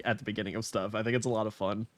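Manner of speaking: normal talking voice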